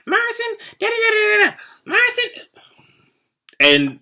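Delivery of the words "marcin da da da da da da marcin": high, nagging singsong calling of a name; nagging monitor. Retold or imitated. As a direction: harp on the boy's name, high and shrill-toned. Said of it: "Here comes Miss Blackshear".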